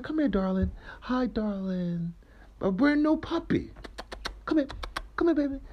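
high cooing talk like to a puppy; high sing-song daddy voice; baby-talk voice. Retold or imitated. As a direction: coo at her like a little girl. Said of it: "the same voice that you talk to your daughters in".

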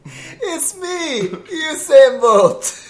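slow, high mock Jamaican accent. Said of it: "can we get a taste of your Jamaican accent?".

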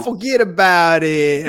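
slow, low Brooklyn "Forget about it"; mock Brooklyn accent. Host echoing the guest's Brooklyn line, in a slow mock Brooklyn accent. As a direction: lean into a hammed-up Brooklyn drawl. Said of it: "I meant Brooklyn accent".

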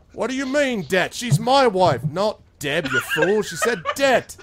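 loud, high tavern squabble; arguing patrons. Acted. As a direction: shout each other down over a misheard word.

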